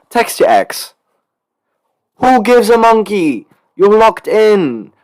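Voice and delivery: loud, high, stretched-out exhortation; hyped-up rallying cry. Pretend. Podcaster urging fans to lock in, hyped shout. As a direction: whip the fans up at full voice.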